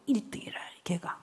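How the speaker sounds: hushed aside, barely voiced; whispered reveal. Real, not acted. 청중에게 아이의 정체를 속삭이며 밝히는 말투. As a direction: let the secret slip in a whisper. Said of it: "whispers the reveal".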